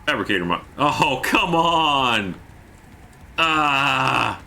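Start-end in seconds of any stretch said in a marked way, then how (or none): 0.8-2.4 long drawn-out, higher-pitched protest; exasperated. Real, not acted. Gamer hit by a setback, exasperated cry. Setback while crafting in game. 3.4-4.4 long, higher-pitched cry of exasperation; frustrated cry. Real, not acted. Gamer after a setback, frustrated cry.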